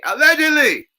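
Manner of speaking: very high, loud, sing-song allegedly; squeaky mock disclaimer. Shown as a demonstration. Dodging a lawsuit over the clip, squeaky mock disclaimer. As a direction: squeal allegedly like a gossip host. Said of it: "I'm not Tasha K".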